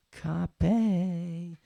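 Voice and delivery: "Copy" as a high, stretched co-PAY; playful mispronunciation. Pretend.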